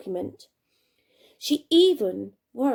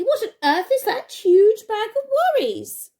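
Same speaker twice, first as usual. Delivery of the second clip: put-on high, louder kindly old lady; 10 semitones higher, 6 dB louder than usual. Old lady neighbour amazed by Jenny's bag, high voice. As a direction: wonder aloud like a sweet grandma. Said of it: "said the old lady".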